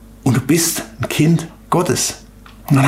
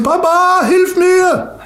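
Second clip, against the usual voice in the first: high, pleading cry to a father; a child's sudden cry for its dad; much slower than usual. Acted. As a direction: cry up like a small child calling for help. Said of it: "und er schreit zum".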